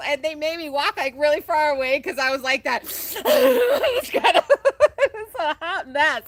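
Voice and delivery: high, loud laughter through the words; giggling, half crying; laughing hard. Real, not acted. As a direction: crack up while telling it. Laughing retelling her sobbing.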